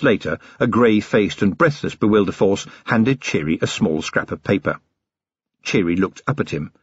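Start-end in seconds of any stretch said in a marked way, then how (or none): none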